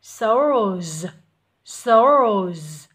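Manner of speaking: "Soldiers" very loud, with a vibrating z-like sound made distinct; clear buzzed consonant. Shown as a demonstration. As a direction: make the buzz in "Soldiers" ring out. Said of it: "con làm rõ cái âm giờ rung ra". ("Soldiers" đọc to, làm rõ âm "giờ" rung)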